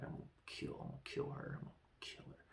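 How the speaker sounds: whispered imitation of a seething rival; very soft, voiceless mock menace; menacing whisper. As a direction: whisper it like a secret death threat. Voices a murderous glare at rival.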